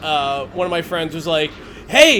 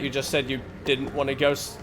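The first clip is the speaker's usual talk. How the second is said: ordinary delivery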